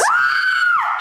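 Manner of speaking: loud high dramatic gasp; shocked gasp. Acted. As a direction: gasp in mock horror.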